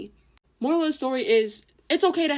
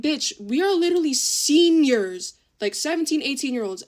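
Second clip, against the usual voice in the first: shocked scolding; 4 semitones higher, 5 dB louder than usual. Retold or imitated. Telling the eighth grader they are seniors, a loud stunned scold. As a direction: hit her with disbelief. Telling off the thirteen-year-old.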